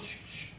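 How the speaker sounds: soft double "shh" effect; imitated gun noise. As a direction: mimic a gun with two quiet hisses. Imitates pulled gun being readied.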